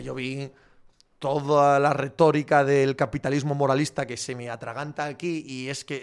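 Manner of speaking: ordinary delivery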